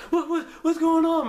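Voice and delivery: high, loud bewildered cry; panicked. Retold or imitated. Teen confused as cops draw guns, high panicked cry. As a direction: shout it in sheer confusion.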